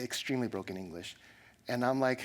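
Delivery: ordinary delivery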